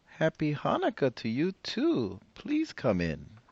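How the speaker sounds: put-on high, bright old granny greeting a guest; old woman voice. Old woman welcoming the rabbi at her door, high and cheery. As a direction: play the cheerful old grandmother.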